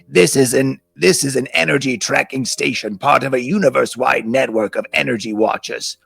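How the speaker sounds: formal, measured ant official; put-on voice of an alien ant; speaking ant. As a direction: announce it like a solemn station guide.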